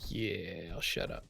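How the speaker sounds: breathy whisper, backing off; whispered aside. Real, not acted. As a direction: drop to a sheepish whisper. Backs off after jinxing.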